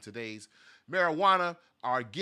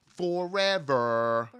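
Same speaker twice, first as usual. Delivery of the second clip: slow, ringing "forever"; drawn-out proclamation; 3 semitones higher, 6 dB louder, much slower than usual. Pretend. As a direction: stretch FOREVER out long and loud. Drawn-out emphasis on comments staying.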